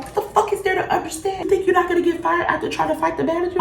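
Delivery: fast, incredulous retold scolding; mom screaming. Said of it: "my mom was screaming at me".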